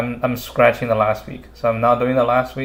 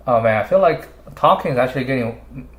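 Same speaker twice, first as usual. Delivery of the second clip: ordinary delivery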